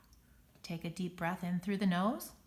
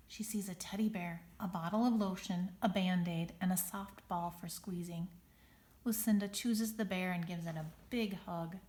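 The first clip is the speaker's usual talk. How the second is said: ordinary delivery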